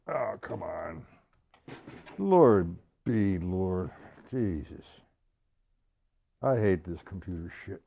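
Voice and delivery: real exasperated grumble, low and soft; muttered frustration. Real, not acted. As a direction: curse the computer under your breath. Computer fails during the lesson.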